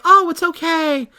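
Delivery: slow, high, sweetly passive-aggressive "it's okay"; sister's passive-aggressive reply, high and slow. Retold or imitated. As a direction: forgive her while making her feel it. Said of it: "she says it super passive aggressively".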